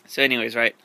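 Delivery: ordinary delivery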